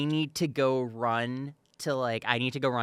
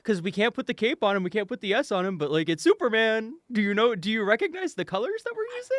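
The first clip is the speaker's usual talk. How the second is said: mock producer voice; 10 semitones higher, 3 dB louder, faster than usual. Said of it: "it's 'cause it's like".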